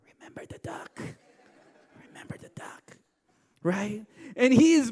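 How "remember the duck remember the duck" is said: whispered threat. Retold or imitated. Sister whispering a menacing reminder to Tim, whispered. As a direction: hiss it softly, almost silent. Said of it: "she'd go".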